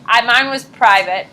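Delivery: ordinary talk, nothing marked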